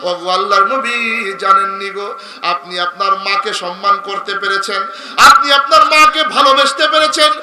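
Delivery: melodic preaching. Real, not acted. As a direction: chant the story, swelling into loud cries. Preacher tells story in melodic chant. (সুরেলা ওয়াজের টানা গলা)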